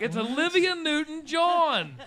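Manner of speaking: high, slow, laughing emphasis; laughing insistence. Retold or imitated. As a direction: stress the name while laughing.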